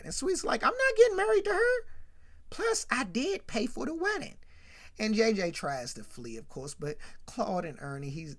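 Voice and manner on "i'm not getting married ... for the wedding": high-pitched, hammed-up objection; protesting. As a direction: argue your way in as the snubbed guest. Sweets protests being kept out.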